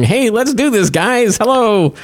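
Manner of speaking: high-pitched, hammed-up eager greeting; Mark Zuckerberg. Host imagines Zuckerberg greeting the kids, high mock-eager voice. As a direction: put on a high, try-hard hello. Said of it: "Mark Zuckerberg coming out and going".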